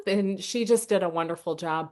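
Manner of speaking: usual speaking voice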